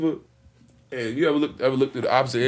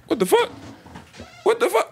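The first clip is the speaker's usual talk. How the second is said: much higher, panicky what the fuck; panicked disbelief; 14 semitones higher, 3 dB quieter than usual. Retold or imitated. Telling friends how he panicked, high and alarmed. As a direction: yelp it in rising alarm. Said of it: "I'm like".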